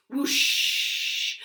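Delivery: breathy wind whoosh. Pretend. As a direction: blow it like the wind. Wind noise effect.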